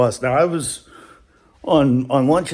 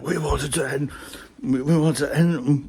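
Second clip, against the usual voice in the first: usual speaking voice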